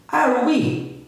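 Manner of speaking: "are we?" high, curious question tune; question intonation. Shown as a demonstration. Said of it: "Dá essa entonação de pergunta". ("are we?" com entonação de pergunta)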